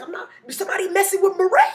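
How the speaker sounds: high and loud, itching for a fight; hammed-up neighbor offering to handle it; eager neighbor. Neighbor protective outburst.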